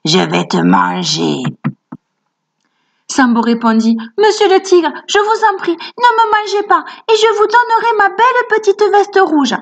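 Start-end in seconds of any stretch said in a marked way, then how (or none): 0.1-1.4 voix de tigre grave et lente; tigre qui gronde. A narrator's reading. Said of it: "un tigre qui gronda". 4.2-9.6 voix d'enfant très aiguë qui supplie; petit garçon suppliant. A narrator's reading. Sambo supplie le tigre.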